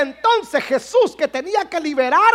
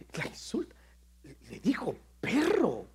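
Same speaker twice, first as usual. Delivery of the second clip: hushed whisper; 7 semitones lower, 14 dB quieter than usual. Acted. Preacher whispers the insult Jesus spoke to the woman. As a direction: drop to a whisper, unvoiced.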